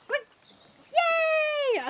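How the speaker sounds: long high-pitched "yay"; high cheer. Real, not acted. Streamer cheers pet's kisses from chat, high squealed cheer.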